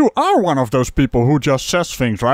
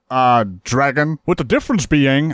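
ordinary talk, nothing marked

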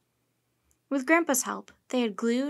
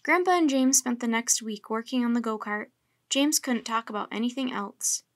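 usual speaking voice

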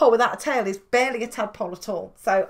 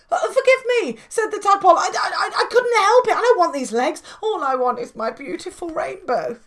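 higher, louder flustered tadpole voice with a stammer; pleading tadpole; 5 semitones higher, 4 dB louder than usual. Acted. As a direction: beg for forgiveness in a panic. Tadpole begs forgiveness.

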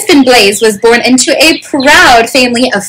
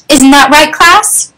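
high, mildly raised question; yelling coach; 6 semitones higher, slower than usual. A narrator's reading. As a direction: coach calling lightly to a class. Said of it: "yelled the coach".

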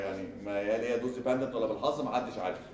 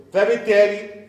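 فبالتالي much higher and louder; emphatic call; 9 semitones higher, 10 dB louder, much slower than usual. Real, not acted. Lecturer to students, loudly launching the conclusion. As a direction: burst out with فبالتالي.

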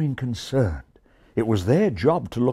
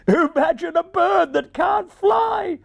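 much higher, louder jeering shout; cruel caw; 5 dB louder than usual. A narrator's reading. Magpies jeering at the ravens, cruel high shout. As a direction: jeer it out cruelly. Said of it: "they cawed cruelly".

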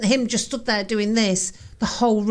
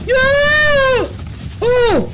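high, loud cry of alarm; startled scream of no, much higher and louder; frightened shout; 12 semitones higher, 6 dB louder than usual. Real, not acted. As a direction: yell out in sudden fear. Sudden event on screen, real fright.

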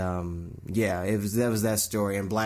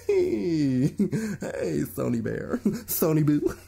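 higher, giggling, coaxing Sony Boo; laughing mock sweet talk; 6 semitones higher, much slower than usual. [name] mock-flirting with Sony.

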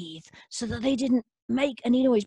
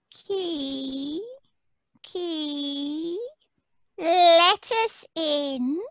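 creatures moaning at the locked door; pleading fairy folk; 5 semitones higher, much slower than usual. Acted. Shut-out fairy creatures begging the key, in a slow high wail.

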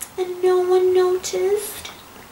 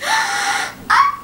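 high shrieking cry of alarm; shocked scream. Acted. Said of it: "screamed Missus Poodlepalm".

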